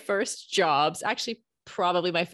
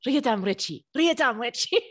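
Russian command; 3 semitones higher, 4 dB louder than usual. Retold or imitated. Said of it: "So I always say".

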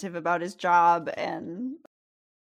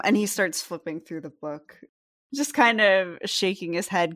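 ordinary delivery

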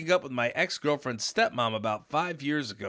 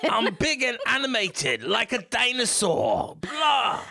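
mock dinosaur; 8 semitones higher, slower than usual. Goofing as a dinosaur.

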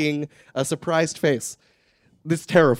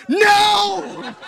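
dismayed cry; 3 dB louder than usual. Retold or imitated.

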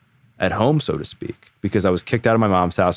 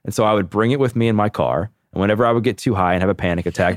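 ordinary talk

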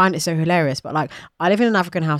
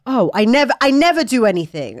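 retold huffy protest, pitched up; mild teenage tantrum; 5 semitones higher than usual. Teenager answering parents' criticism, mildly indignant. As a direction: echo the accusation with mock indignation. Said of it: "bad tantrums as a teenager".

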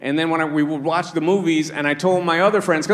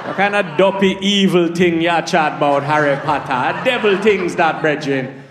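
put-on Jamaican accent. Jamaican friend mocking Harry Potter, in a Jamaican accent. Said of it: "They were like".

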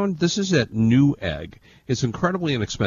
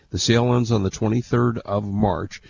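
usual speaking voice

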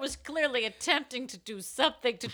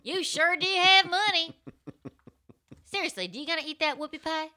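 higher, louder, slow Southern belle with a whoop; Southern accent; 8 semitones higher, 7 dB louder than usual. Acted. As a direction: stretch it out in a big Southern accent. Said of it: "Southern accent".

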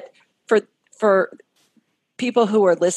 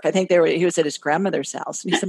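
ordinary talk

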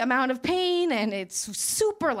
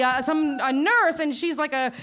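normal talking voice

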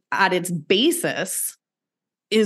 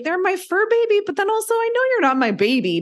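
much higher, fast, mock-gushing fur-baby voice; gushing pet owner; 12 semitones higher, faster than usual. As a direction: squeal it like a doting pet parent, then rush the correction. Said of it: "And you're like".

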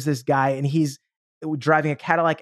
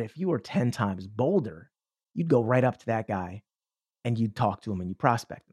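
calm bolder self; 5 semitones lower, 4 dB quieter than usual. Acted. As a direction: speak as your steady, fearless side. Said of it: "that voice came up and said".

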